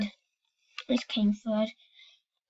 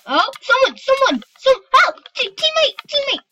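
high, loud panicked calls; calling for help; 11 semitones higher, 7 dB louder than usual. Real, not acted. Gamer under attack yelling to his teammate for help. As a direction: shout for your teammate in a panic.

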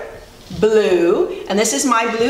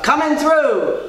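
higher, mildly yelled call; yelling dump truck; 6 semitones higher than usual. A narrator's reading. Dump truck rushing past the animals, mild yell. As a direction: call out to clear the road. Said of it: "yelled a dump truck".

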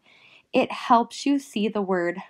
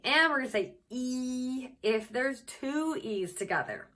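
normal talking voice

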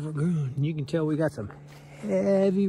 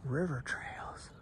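whispering; 8 dB quieter, slower than usual. Real, not acted. Quiet hiking narration.

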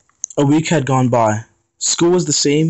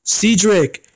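higher-pitched cry of his name; shout; 8 semitones higher than usual. Acted. Aries yelling to Cedric hit by the truck, alarmed shout. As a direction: call out to him as the truck hits. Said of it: "Aries shouted".

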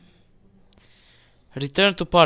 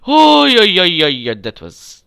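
startled gasp into relief; 5 semitones higher, 14 dB louder than usual. Real, not acted. Narrow escape in game.

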